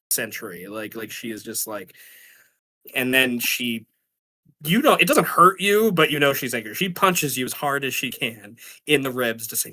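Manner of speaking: ordinary talk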